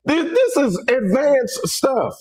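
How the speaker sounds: high, slow words through laughter; amused, laughing. Real, not acted. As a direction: laugh at yourself while you say it. Laughing at his unfinished highlights.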